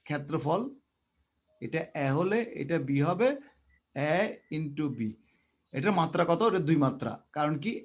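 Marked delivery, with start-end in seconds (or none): 3.9-5.1 slow, deliberate. Shown as a demonstration. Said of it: "আস্তে আস্তে বলতেছি".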